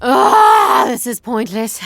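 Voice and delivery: exasperated yell at the pointless studying; frustrated outburst. Acted. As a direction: throw the book down and shout it out.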